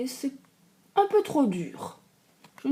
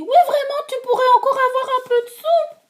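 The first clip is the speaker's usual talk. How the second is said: very high, loud, quick cub from afar; baby bear calling; 7 dB louder, faster than usual. Acted. Baby bear calling to Goldilocks from a distance, squeaking. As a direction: call it far after her like a tiny bear. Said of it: "lui crie".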